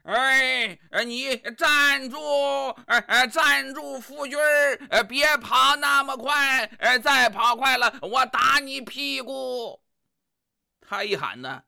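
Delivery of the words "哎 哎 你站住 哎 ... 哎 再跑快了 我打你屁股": bossy wife shouting. Acted. Wife chasing her runaway husband, higher scolding shouts. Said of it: "后面那位一个劲的喊".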